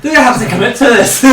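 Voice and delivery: laughing mock Scottish accent; high, fast Scottish impression. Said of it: "I was about to do a Scottish accent".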